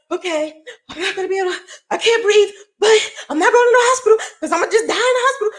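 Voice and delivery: high-pitched, crying refusal; tearful panic. Retold or imitated. Woman recalls refusing hospital while unable to breathe, tearful and panicky. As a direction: rush it out high, close to tears.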